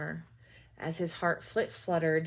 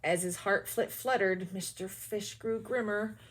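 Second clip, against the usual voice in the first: usual speaking voice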